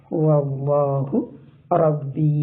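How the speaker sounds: slow, measured verse recitation; Quran recitation. Shown as a demonstration. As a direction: recite with sustained long vowels.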